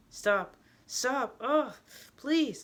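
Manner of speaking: high, loud cries of stop; panicked shouts, pitch climbing; desperate pleading scream. Acted. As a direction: beg someone to stop hurting you. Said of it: "I scream, struggling to break free".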